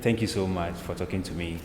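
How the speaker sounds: usual speaking voice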